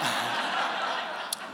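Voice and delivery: quiet unvoiced chuckle; low airy chuckle; soft breathy laugh. Real, not acted. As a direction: laugh softly at yourself. Laughs at own accent remark.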